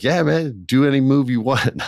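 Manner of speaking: high, eager mock bravado; hyped. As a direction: chirp it like a pumped rookie. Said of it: "And so I'm hyped".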